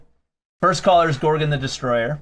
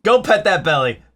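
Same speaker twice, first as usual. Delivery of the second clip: eager loud urging; excited; 4 semitones higher, 4 dB louder than usual. Real, not acted. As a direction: egg him on. Urging to pet dog.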